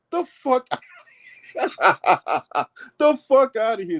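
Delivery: slow, drawn out through a real laugh. Real, not acted. Host laughing off an absurd quote, slow and laughing. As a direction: laugh it out in disbelief.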